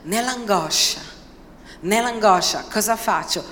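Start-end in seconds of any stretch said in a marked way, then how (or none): none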